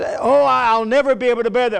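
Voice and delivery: preacher mocking a defeated believer with a quick high whine; mock complaint. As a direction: mimic the self-pitying complainer, fast and high. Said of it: "it's not just".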